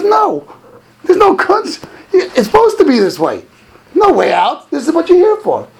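high, forceful retold rebuke; acted emphatic refusal. Rabbi refusing a request for easy child-raising, acted emphatic refusal. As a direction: imitate a rabbi laying down the law.

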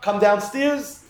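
usual speaking voice

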